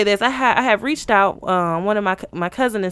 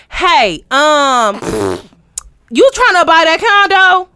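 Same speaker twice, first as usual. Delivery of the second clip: imitating a loud, high-pitched phone caller; mock pushy realtor, loud and high; too loud caller; 5 semitones higher, 6 dB louder, slower than usual. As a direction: blurt it loudly into the phone. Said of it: "can you bring it down?".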